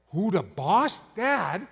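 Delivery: lightly imitated kids asking Dad, rising at the end; kids' voices. Kids relaying the guide's question to Dad, lightly mimicked. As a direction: echo the guide's words as a child would. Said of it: "They said".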